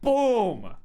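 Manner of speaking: loud, high shouted boom; hammer-blow boom. Acted. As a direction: punch out the impact, falling in pitch. Imitates third hammer blow.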